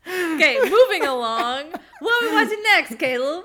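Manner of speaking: after laughing hard. Real, not acted. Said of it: "let me compose myself".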